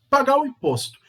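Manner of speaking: ordinary delivery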